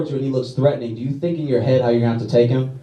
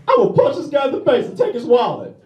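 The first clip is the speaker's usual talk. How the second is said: higher, lightly voiced thug; mugger's plan; 9 semitones higher than usual. Comic voicing the mugger who robbed him, lightly higher. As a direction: announce the robbery in a goofy mugger voice. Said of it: "His plan was".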